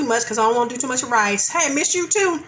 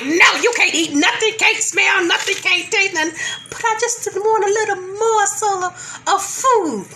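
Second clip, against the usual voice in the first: voicing covid; 7 semitones higher than usual. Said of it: "covid was like".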